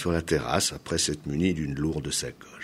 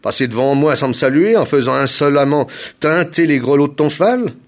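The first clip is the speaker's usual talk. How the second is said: affronted, raised voice; indignant cry; 6 semitones higher, 7 dB louder than usual. A narrator's reading. Governor berating a traveller for passing without saluting, indignant. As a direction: scold loudly with offended pride. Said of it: "s'écria Gong Jing".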